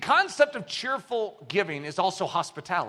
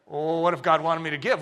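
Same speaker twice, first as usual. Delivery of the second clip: ordinary delivery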